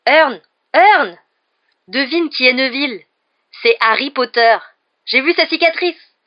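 loud, high, thrilled cries; excited shouting. A narrator's reading. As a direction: shout the great news to your mate. Stan shouts the news to Hearn.

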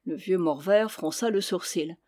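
plain reading voice

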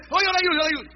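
high, loud eager volunteer; hammed-up eager helper; eager offering. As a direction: beg to be the one who helps. Another man eagerly offering help.

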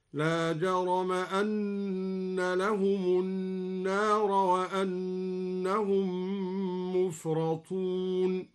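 slow melodic tajwid chant; measured, drawn-out Quranic chanting; Quran recitation. Shown as a demonstration. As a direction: recite slowly with long-held nasals and vowels.

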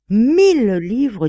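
slow, high, loud and stretched first word; amazed exclamation. A narrator's reading. Artist marvelling at the price, amazed. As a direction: marvel at the sum, drawing it out.

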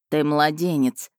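slow, dismissive dragon voice; amused scoff. A narrator's reading. Dragon belittles the heroine's age, amused scoff. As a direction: lightly scoff in a character voice. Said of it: "хмыкнул он".

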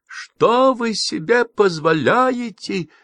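high, slow, drawn-out yell; a furious woman bellowing at him. A narrator's reading. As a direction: scream the outrage slowly. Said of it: "Просто-таки заорала".

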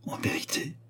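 shocked, voiceless whisper; hushed, troubled disbelief; troubled exclamation. A narrator's reading. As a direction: gasp it out in a stunned whisper. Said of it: "tout troublé par la jalousie et la colère".

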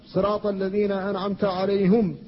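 "عليهم" with ه and م both ending in u, "عليهُم"; alayhum reading. Shown as a demonstration. Teacher recites the first reading. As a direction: end "عليهم" with a u sound. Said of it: "كلها قراءات".

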